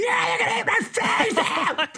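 way up high, laughing while shouting; mocking laugh-shout. Mocking a follower who swallows the fear, high laughing shout. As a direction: play the gullible fan, cracking up.

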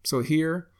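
ordinary talk, nothing marked

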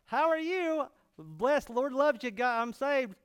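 a touch of gushing weirdo believer; lightly put-on weirdo churchgoer greeting. Preacher mimicking the weirdo met at Walmart, mock gushing. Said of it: "like the weirdo guy".

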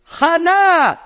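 high, drawn-out mock scream; screamed name. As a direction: scream the name from across the synagogue. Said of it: "And she screams out".

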